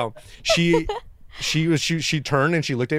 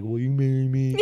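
awkward retold proposal. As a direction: stretch out the proposal self-consciously. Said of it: "Why you gotta say it like that?".

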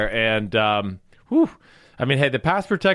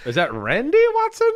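high joking voice; 11 semitones higher than usual. Pretend. Joking mock name, high voice.

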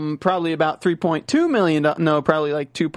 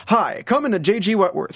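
higher cheesy TV ad pitch; infomercial; 4 semitones higher than usual. Pretend. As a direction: sell it like a late-night commercial. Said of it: "That saying literally sounds like an infomercial".

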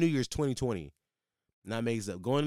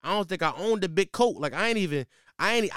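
usual speaking voice

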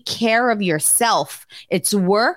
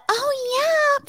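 high, drawn-out exclamation; signature oh yeah. Shown as a demonstration. Podcaster showing the exclamation she always does, high-pitched. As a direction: demonstrate the squealed catchphrase. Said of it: "I do the".

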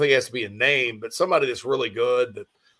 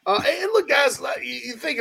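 excited outburst; 13 semitones higher than usual. Real, not acted. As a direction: pitch jumps high, calling out. Host rallying listeners, voice jumps up.

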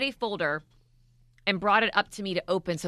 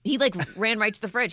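usual speaking voice

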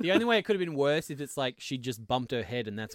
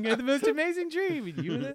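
dreamy Dorothy voice; 12 semitones higher than usual. Pretend. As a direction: sigh it out like waking from a dream. Quoting Wizard of Oz dreamily.

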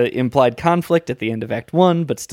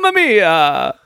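mock Italian; 3 semitones higher, 4 dB louder, slower than usual. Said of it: "would sound like Mario and Luigi".

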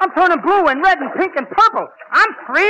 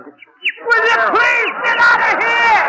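slow, higher, shouted get out; exasperated shout; 7 semitones higher, much slower than usual. Acted. Costello shooing the sound man away, exasperated slow shout. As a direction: shout him off the stage.

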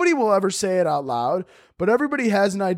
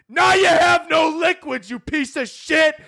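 loud, slow, high insult; guard pouring water over his head; guard yelling; 13 semitones higher, 4 dB louder, slower than usual. Retold or imitated. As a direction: bark it down at him. Guard dousing him, insulting.

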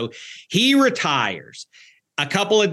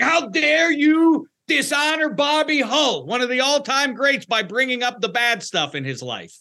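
indignant pushback; 10 semitones higher than usual. Retold or imitated. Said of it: "a lot of pushback on".

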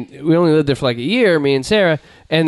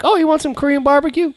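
high put-on voice offering food; friendly high neighbor voice; neighbor lady voice; 8 semitones higher than usual. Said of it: "she'd be like".

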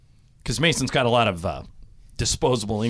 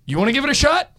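Lasorda teasing invite; 9 semitones higher, faster than usual. Retold or imitated.

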